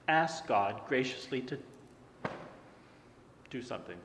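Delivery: ordinary talk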